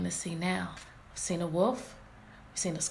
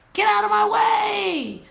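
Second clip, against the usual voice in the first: higher, louder and slow, a full furious shout; Red yells at the wolf to move, loud and high; 11 semitones higher, 9 dB louder than usual. Acted. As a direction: yell it at the wolf blocking her path. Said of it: "My voice sounds really funny".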